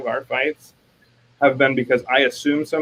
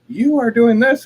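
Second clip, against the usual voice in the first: high, loud retold accusation; mock accusation; 11 semitones higher, 6 dB louder than usual. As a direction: hurl the accusation, imitating a fight. Acted quarrel with spouse.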